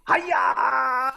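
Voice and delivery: very high squeaky whispered greeting; talking eyeball. Pretend. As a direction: chirp it like a tiny toy creature. Eyeball character says hello.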